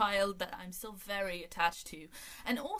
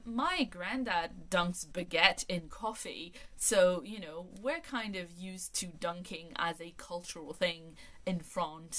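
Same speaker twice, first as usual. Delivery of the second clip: normal talking voice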